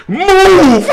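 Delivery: high, loud yell of "Move!" barked at the janitor; rude shout. Retold or imitated. Shouting "Move!" at the janitor in his path. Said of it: "just rudely".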